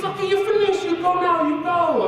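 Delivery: put-on high-pitched excited Chinese-accented examiner; hammed-up Chinese accent, very high; thick Chinese accent. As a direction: announce it as a thrilled Chinese lady. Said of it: "she had a really thick Chinese accent".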